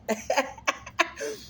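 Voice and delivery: high giggle; high laugh. Real, not acted. Vlogger amused after warning viewers, laughing high.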